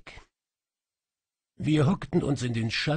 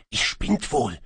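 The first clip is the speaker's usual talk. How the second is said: hissed; 2 semitones higher, 4 dB louder than usual. A narrator's reading. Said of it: "zischte er".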